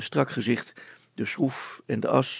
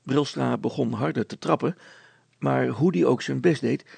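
usual speaking voice

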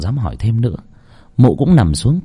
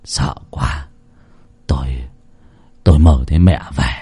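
lower, breathy, shaken; breathless fear; 8 semitones lower than usual. A narrator's reading. Husband gasps to his wife after the nightmare. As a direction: pant it out scared. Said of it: "hổn hển".

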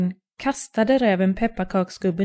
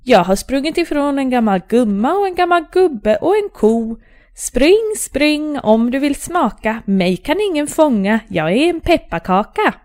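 high, louder sing-song taunt; cheeky runaway shouting over his shoulder; gingerbread man calling out; 5 semitones higher, 5 dB louder than usual. A narrator's reading. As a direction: shout the taunt back gleefully. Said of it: "pepparkaksgubben ropade".

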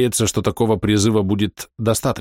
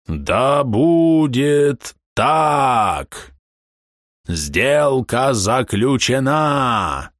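booming creature proclamation; 3 semitones higher, much slower than usual. A narrator's reading. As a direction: proclaim it like a fire herald. Herald seals the deal.

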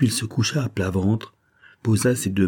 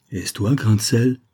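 ordinary delivery, nothing marked

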